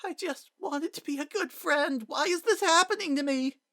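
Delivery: put-on a boy pleading through tears; desperate crying child. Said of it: "he cried".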